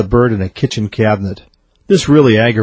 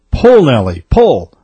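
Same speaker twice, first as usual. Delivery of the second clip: raised farmer's yell; yelled command; 3 semitones higher, 4 dB louder, slower than usual. A narrator's reading. Farmer urging his horse at the ditch, yelling. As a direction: call out to drive a horse on. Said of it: "hitched Buddy up to the car and yelled".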